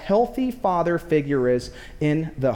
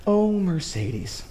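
slow, drawn-out imitation of a tender mother; imitated wife, gently cooing; sweet loving mom; slower than usual. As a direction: soothe a child in a slow mom voice. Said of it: "That's exactly how she sounds".

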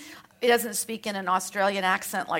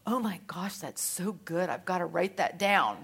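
hushed amazement; 5 semitones lower, 7 dB quieter than usual. Retold or imitated. Listener amazed by your words.

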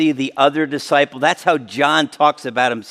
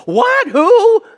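startled; 5 dB louder than usual. Pretend. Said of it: "it's like".

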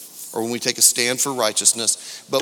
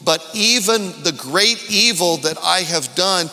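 rising, louder preacher's cadence over music; preaching at full force; 6 semitones higher, 5 dB louder than usual. Acted. Pastor to his new church, preaching louder and higher. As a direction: lift the room, preach it out.

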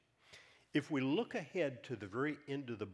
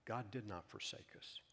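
ordinary talk

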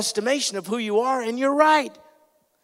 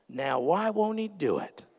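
ordinary talk

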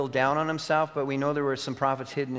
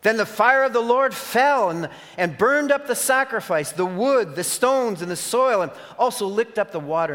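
high, loud climax as the fire falls; sermon peak on the fire from heaven, loud and high; triumphant preaching; 8 semitones higher, 6 dB louder than usual. Acted. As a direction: proclaim the fire with awe.

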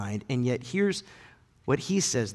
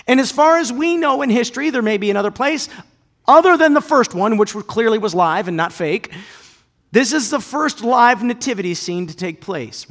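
loud, high, driving sermon voice; full-force preaching; 10 semitones higher, 12 dB louder than usual. Acted. Preacher proclaiming the cave nativity, full force. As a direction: proclaim it to the whole congregation.

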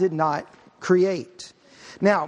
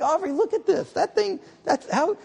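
higher, wide-eyed imitation of his wife in awe of the moon; imitating my wife; 11 semitones higher than usual. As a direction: play the wife gushing over a huge moon. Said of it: "she's like".

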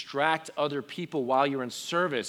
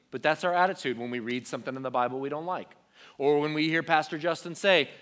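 ordinary delivery

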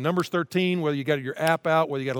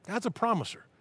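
"God's a promiser" lifted higher and softer; raised, hushed echo of "God's a promiser"; higher, softer repeat; 7 semitones higher, 4 dB quieter than usual. Real, not acted. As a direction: repeat "God's a promiser" high and gentle for effect. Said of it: "repeated line".

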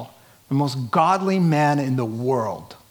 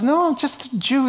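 mock innocent; 11 semitones higher than usual. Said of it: "And he goes".

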